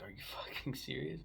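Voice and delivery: laughing whispered disbelief; stunned whisper. Retold or imitated. Telling cousin off for skipping Cujo, whispered disbelief. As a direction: hiss it out in amazed disbelief.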